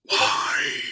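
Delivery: anguished cry. Real, not acted. Frustrated cry.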